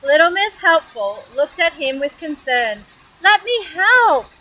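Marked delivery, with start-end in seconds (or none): 3.2-4.3 high, loud, drawn-out offer; over-keen helper calling out slowly; eager cry. Acted. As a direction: sing out eagerly, stretching the words. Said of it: "she cried".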